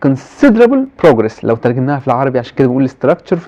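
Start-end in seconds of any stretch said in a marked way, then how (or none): 0.1-1.4 considerable progress said slowly as a model; teacher modelling the phrase. Shown as a demonstration. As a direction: read considerable progress slow and clear. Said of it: "في الانجليش بنقولها ازاي؟".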